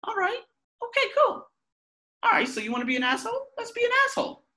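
retelling his sarcastic reply to his partner in a high mock voice; sarcastic retort. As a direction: fake brightness before snapping back. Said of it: "And I'm like".

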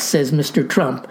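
ordinary talk

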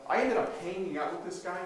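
normal talking voice

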